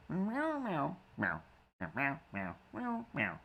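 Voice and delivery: high, playful meowing; silly high kitty meows; cat meows. Pretend. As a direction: answer as the cat. Voicing the cat back.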